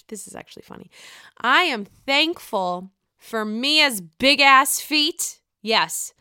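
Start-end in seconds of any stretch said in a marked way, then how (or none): 0.1-0.9 amused whisper. Real, not acted. Amused aside after laughing.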